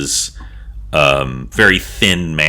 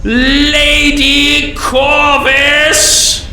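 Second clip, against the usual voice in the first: very high, loud, hugely stretched announcement; obsequious loud herald; 5 dB louder, much slower than usual. Acted. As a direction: cry out the name like a fawning herald. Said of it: "in a very obsequious, loud voice".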